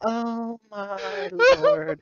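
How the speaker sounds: slow, high, laughing cry. Real, not acted. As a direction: wheeze it out through laughter. Laughing at the joke.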